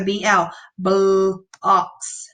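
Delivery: "B L, blocks" with the letters named slowly, then the blend; slow phonics blending; blending sounds. Shown as a demonstration. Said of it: "blending those two letters together".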